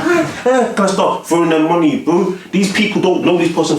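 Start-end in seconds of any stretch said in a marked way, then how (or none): 0.0-0.7 imitating fans screaming, much higher; a quick burst of high fan screaming; screaming crowd. Fans jumping up and down, high imitated screaming.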